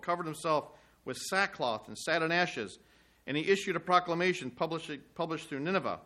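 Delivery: measured reading of scripture. Acted. Preacher reading Jonah's prayer to the congregation, measured. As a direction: read the prayer steadily to the listeners. Preacher reads chapter three aloud.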